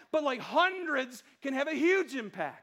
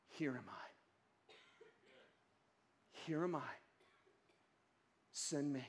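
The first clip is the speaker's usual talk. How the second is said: soft, low and slow surrender; quiet offering; 10 semitones lower, 11 dB quieter, slower than usual. Acted. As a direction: say it like a humble whisper of yes. Voices the answer God wants.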